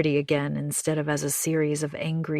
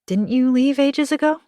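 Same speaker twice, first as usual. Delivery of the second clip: higher, louder surprised neighbour; surprised June; 8 semitones higher, 6 dB louder than usual. Acted. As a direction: pop up in pitch, surprised. Anna voices June's surprise.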